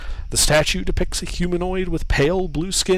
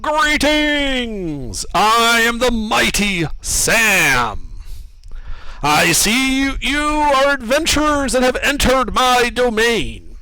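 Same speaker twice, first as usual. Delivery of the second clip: booming statue voice; 7 semitones higher, slower than usual. Acted. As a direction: boom it out like a mighty statue. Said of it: "A booming voice echoes from the statue".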